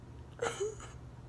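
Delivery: quiet high sob; real soft sobbing; crying. Real, not acted. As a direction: sob under the breath. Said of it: "they just kinda came out".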